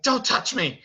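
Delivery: high, loud protest; acted distressed cry. Belle on the ground to Beast, high distressed shout. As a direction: cry out to push him away.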